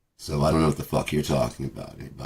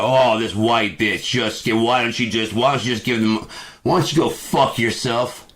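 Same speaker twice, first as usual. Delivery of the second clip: higher, sneering rant; mock hostile commenter; 6 semitones higher than usual. Vlogger voicing commenters attacking the cashier, sneering. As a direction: spit it out like an online troll. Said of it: "you guys all fight".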